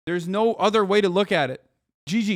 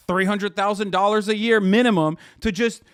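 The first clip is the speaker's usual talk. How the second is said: ordinary talk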